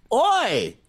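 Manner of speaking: a hammed-up Australian "Oi!" call; mock Australian oi. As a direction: bark "Oi!" like a cartoon Aussie. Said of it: "our impression of an Australian".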